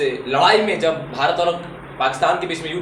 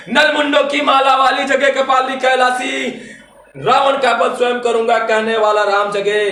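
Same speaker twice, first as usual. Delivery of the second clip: high, louder declamation of patriotic verse; fiery poetry recitation; 8 semitones higher, 6 dB louder than usual. Acted. Poet rousing the audience to wake India, fiery declamation. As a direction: declaim it as a rousing call to arms.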